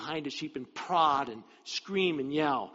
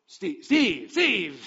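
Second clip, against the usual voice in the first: loud, higher mock calls; calling out a name; 5 semitones higher, 8 dB louder, much slower than usual. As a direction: call the name over and over, trying to get attention. Mimics calling out the wrong names.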